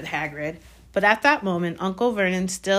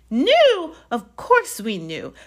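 high shriek easing into drawn-out scorn; shriek, then slow down with contempt; indignant aunt's outburst; 8 semitones higher, slower than usual. A narrator's reading. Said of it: "shrieked Aunt Petunia suddenly".

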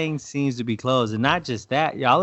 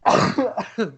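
harsh hacking cough; coughing fit; 10 semitones higher than usual. Real, not acted. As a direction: cough out the bug. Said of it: "I think I swallowed a bug".

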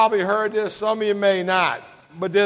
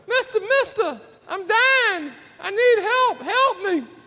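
high, thin put-on snake voice; squeaky helpless critter, drawn-out plea; pleading snake; 12 semitones higher than usual. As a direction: beg for help in a high, pitiful character voice.

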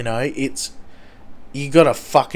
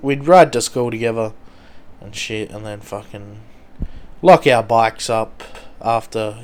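ordinary talk, nothing marked

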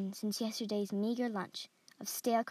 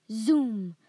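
loud drawn-out "zoom" swooping down; arrow zoom; 10 dB louder than usual. A narrator's reading. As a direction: whoosh the word like a flying arrow. Narrator voices arrow's flight.

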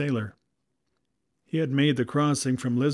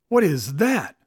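alarmed, louder question; startled cry; 4 semitones higher, 8 dB louder than usual. A narrator's reading. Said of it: "she cried out".

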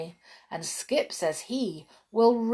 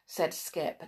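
plain reading voice

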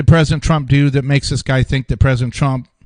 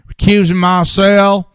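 a Southern accent, higher and louder, mocking; 4 semitones higher, 4 dB louder than usual. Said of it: "he mocked in a, his Southern accent".

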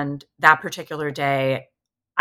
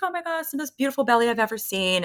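high, quick mock gushing; imitated gushing; 9 semitones higher, faster than usual. Midwife admiring the pregnant belly on the table, imitated gushing.

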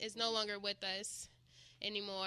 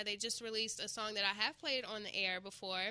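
ordinary talk, nothing marked

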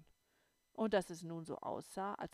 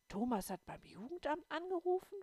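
much higher incredulous question; disbelieving; 10 semitones higher, 4 dB louder than usual. A narrator's reading. Little girl unable to believe Thomas called, high and disbelieving. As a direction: ask it as if it can't be true. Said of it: "fragte ich ungläubig".

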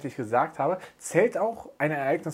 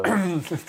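throat clearing cough; 5 semitones higher, 4 dB louder than usual. Real, not acted. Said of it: "Da tut das Bier im Rachen weh".

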